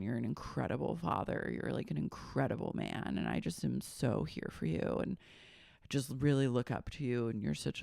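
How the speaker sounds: soft, low, gushing flattery; mock gushing praise. Friend flattering a buddy, softly gushing. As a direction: lay the praise on thick and hushed. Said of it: "Jesse's like".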